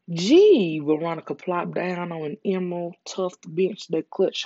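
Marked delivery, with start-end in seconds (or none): 0.1-0.8 shocked gasp. Acted. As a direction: burst out in shock. Veronica shocked by news.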